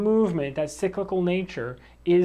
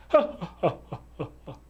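real laughter; faster than usual. Real, not acted. Teacher cracks up at his own demo, laughing. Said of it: "That would look weird".

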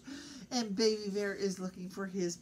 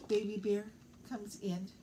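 ordinary delivery

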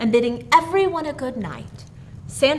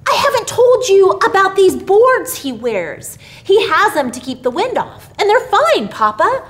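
put-on high, loud eager child's voice; little boy excitedly explaining to papa; 10 semitones higher, 8 dB louder than usual. A narrator's reading. Billy tells papa about the boards, eagerly.

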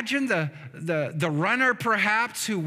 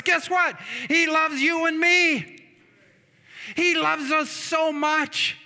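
raised, high-pitched sermon delivery; impassioned preaching; 6 semitones higher than usual. Real, not acted. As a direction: preach it with rising fervour. Preaches at full force.

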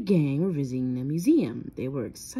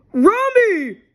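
high, loud dog-voice exclamation; loud, high-pitched doggy yelp; Scooby's bark; 14 dB louder than usual. A narrator's reading. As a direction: bark it out like a panicked cartoon dog. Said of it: "barked Scooby".

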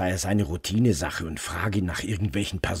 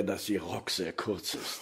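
soft, sheepish laugh through the words; laughing while admitting; 3 semitones lower, 12 dB quieter than usual. Acted. Detective admits why he looks away, laughing softly. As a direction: confess it with an embarrassed laugh. Awkward admission about her skirt.

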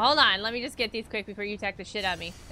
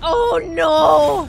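panicked shout; 5 semitones higher, 13 dB louder than usual. Real, not acted. Spotted enemies, panics.

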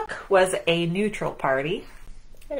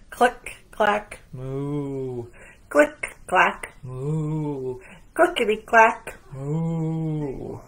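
typing sounds; 3 semitones lower, much slower than usual. A narrator's reading. Reading to kids, clicking keys then a low moo. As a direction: click clack crisp and lively, moo dropped low.

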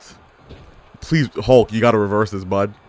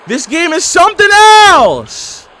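much higher and louder, stretched out slowly; loud disbelief; 7 dB louder, slower than usual. Real, not acted. Gamer yelling at an absurd wrestling game, slow loud outcry. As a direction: cry it out like you can't believe your eyes.

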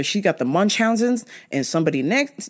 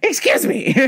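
high mock polite; 12 semitones higher than usual.

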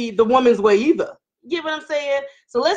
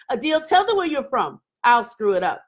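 usual speaking voice

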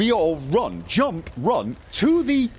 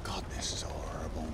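whispering; 15 dB quieter than usual. Real, not acted. Quiet aside during tense game.

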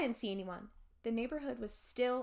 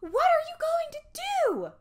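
terrified scream; 9 dB louder than usual. Acted. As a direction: scream at the closing ghosts. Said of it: "I screamed in a voice".

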